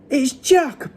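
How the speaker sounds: high-pitched bellow of terror. A narrator's reading. Giant dreaming of Jack, bellowing in fright. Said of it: "bellowed the flesh lump eater".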